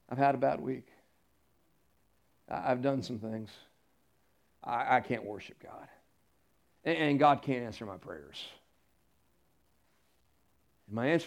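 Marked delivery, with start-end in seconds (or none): 0.1-8.4 a hint of a defeated believer, much lower and softer; discouraged churchgoer. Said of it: "they just have this thought that says".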